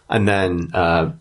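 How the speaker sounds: normal talking voice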